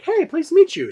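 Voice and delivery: high, sugary fake-polite hello; mock sweet greeting. Narrator mocks the friendly greeting the girlfriend never gave, high and sugary. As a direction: chirp it like a fake-friendly welcome.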